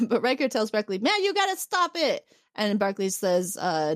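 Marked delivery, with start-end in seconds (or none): 1.0-2.2 high-pitched mock plea to stop; hammed-up exasperated order, pitched high; urgent plea. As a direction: plead it way up high, half-joking. Riker orders Barclay to stop, retold.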